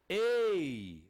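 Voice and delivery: EI held long and loud, like "ay"; vowel sound demo. Shown as a demonstration. Teacher showing what the two signs sound like, long loud vowel. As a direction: stretch "ei" out firmly, falling at the end. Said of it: "isso aqui forma o som de".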